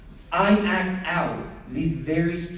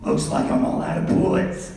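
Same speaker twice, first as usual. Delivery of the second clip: action hero line; 2 semitones lower than usual. Pretend. Kid playing action hero.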